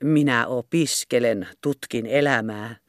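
a hint of a smug robber, slowed down; mocking robber quote. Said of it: "ryöstellä ja sanella".

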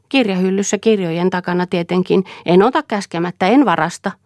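put-on a strange girl recalling cruelty with relish; simple-minded servant girl. A narrator's reading. Silja voiced by narrator. (korkeampi tytön ääni, oudon innostunut)

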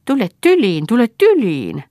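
put-on high, loud lisping coo to a child; coaxing baby talk. A narrator's reading. Big bearded man inviting small Yrjö into his arms, coaxing. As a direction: coax a toddler into your arms.

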